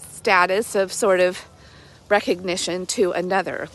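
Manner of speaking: ordinary talk, nothing marked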